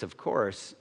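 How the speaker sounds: ordinary delivery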